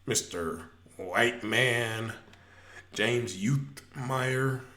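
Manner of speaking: drawn out, very slow; slow formal address. Real, not acted. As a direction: say the name slowly and deliberately. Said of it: "let me start off first, sir".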